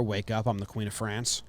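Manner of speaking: ordinary talk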